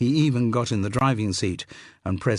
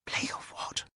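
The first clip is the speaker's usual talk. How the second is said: breathy unvoiced whisper, much softer; hushed whispered question; 10 dB quieter than usual. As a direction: whisper back so the chauffeur can't hear. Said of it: "Blotto whispered back".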